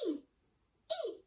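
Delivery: short high mouse squeaks. Shown as a demonstration. Teacher demonstrating a mouse squeak for children, high squeaky. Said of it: "can you practice your squeak with me".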